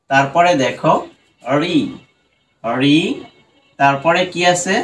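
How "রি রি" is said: রি drawn out as a separate syllable; broken into syllables. Shown as a demonstration. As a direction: sound out রি alone, with a pause after it. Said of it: "ভেঙে ভেঙে উচ্চারণ করি".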